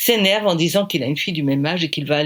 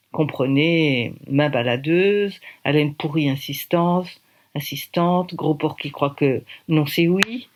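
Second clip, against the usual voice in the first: ordinary delivery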